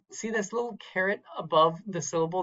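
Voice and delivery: ordinary talk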